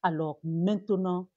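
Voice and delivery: ordinary talk, nothing marked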